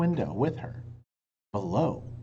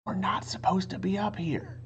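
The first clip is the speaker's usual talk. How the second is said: hushing whisper; 6 semitones higher than usual. A narrator's reading. Jack hushes Annie in the treehouse in a whisper. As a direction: hush her fast. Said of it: "said Jack".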